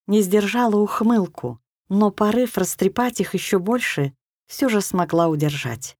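plain reading voice

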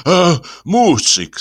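high, drawn-out winded sigh; slow, puffed exclamation; breathing heavily. Acted. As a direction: greet the wife as if out of breath. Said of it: "тяжело дыша".

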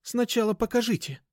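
put-on high-pitched girl insisting; firm high-voiced demand. A narrator's reading. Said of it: "требовательно проговорила Даша".

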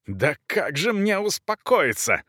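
high, slow indignant question; still-fuming countess, high-pitched; enraged. A narrator's reading. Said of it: "Разъярённая графиня не остывала".

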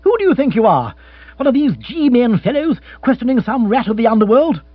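sarcastic outburst at a prying partner; rant gathering momentum. Acted. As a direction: build up steam and tell her off. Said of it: "gathering momentum".

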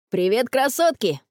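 put-on boy's cheery greeting in a changed voice; merry teasing boyish hail; cheerful call. A narrator's reading. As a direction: call out a jaunty hello to the girls. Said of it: "весело крикнул Филипп".

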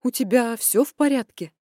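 worried mother. Acted. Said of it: "её встревоженный голос".

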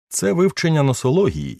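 high, emphatic instruction; earnest father. Acted. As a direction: lecture the son with conviction.

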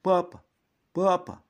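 high, loud call for papa; child shouting. A narrator's reading. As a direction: call out to his father. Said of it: "закричал подбежавший мальчик".

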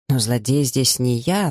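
plain reading voice

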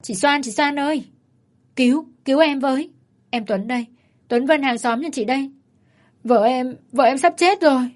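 pleading shout, high-pitched; desperate shout. A narrator's reading. Man begs the neighbour to save his dying wife. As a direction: cry out for help in panic. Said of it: "Tuấn hét lên".

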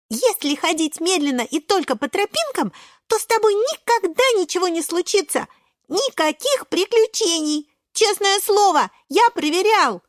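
put-on high, insistent child character; earnest little Шпинатик. Шпинатик explaining to doubting friends, high and insistent. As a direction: plead the case in a high, emphatic voice.

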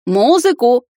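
a prince calling to the maestro for music; shouted. A narrator's reading. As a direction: call out to the musicians. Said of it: "закричал мужчина громче".

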